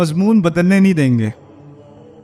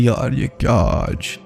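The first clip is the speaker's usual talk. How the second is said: gossiping guard; 7 semitones lower, 4 dB quieter, slower than usual. Retold or imitated. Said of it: "security guard ہے".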